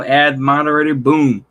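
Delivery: ordinary delivery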